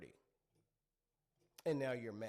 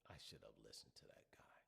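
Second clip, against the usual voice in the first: whispered inner regret; a hushed thought of regret; regretful whisper; 18 dB quieter than usual. Acted. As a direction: breathe it like a private admission. Regret voiced in their mind.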